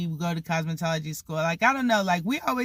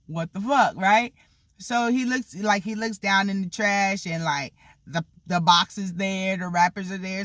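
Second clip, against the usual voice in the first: ordinary talk, nothing marked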